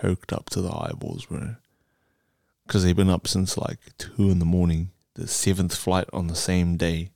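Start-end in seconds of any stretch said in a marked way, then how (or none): 0.0-1.5 unvoiced conspiratorial whisper. Real, not acted. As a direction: lean in and whisper it. Gossiping about pilots.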